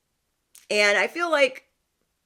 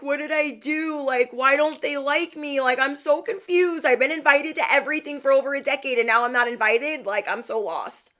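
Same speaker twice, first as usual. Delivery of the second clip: tearful confusion; 7 semitones higher than usual. Retold or imitated. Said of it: "I start crying".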